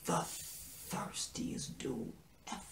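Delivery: slow, drawn-out whisper; awed whisper. Real, not acted. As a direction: whisper it slowly for dramatic effect. Dramatic aside, disbelief at the character.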